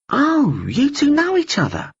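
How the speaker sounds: put-on a keen young believer butting in; eager young man.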